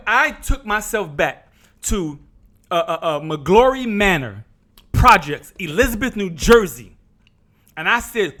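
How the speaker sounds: ordinary talk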